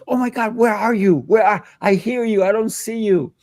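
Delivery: put-on a little girl hears her teacher but can't see him, amazed. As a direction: squeal it like a five-year-old who can't find you. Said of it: "And then she goes".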